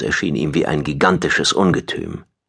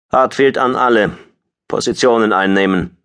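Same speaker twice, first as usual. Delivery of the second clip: crisp higher-pitched squadron order; commanding order; 4 semitones higher, 3 dB louder than usual. A narrator's reading. Squadron leader to his squadron, commanding. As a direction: issue an order over the com. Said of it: "befahl er an seine Staffel".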